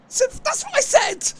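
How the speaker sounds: indignant protest. Real, not acted. Streamer to chat, protesting their name corrections in mock outrage.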